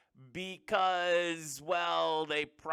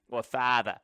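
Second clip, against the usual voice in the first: "father" in a Boston accent, no r: fahthah; 5 semitones lower than usual. Shown as a demonstration. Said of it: "if I was to use my Boston accent".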